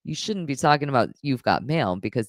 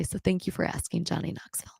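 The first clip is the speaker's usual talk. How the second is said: whisper; 3 semitones higher, 8 dB quieter, faster than usual. Real, not acted. Thanks donor after emotional talk.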